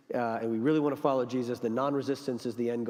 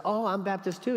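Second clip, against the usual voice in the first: higher-pitched eager discovery; delighted joke character; 7 semitones higher than usual. Retold or imitated. Joke teller voicing a fellow Baptist, delighted. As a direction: voice a pleased find of common ground. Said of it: "The guy's like".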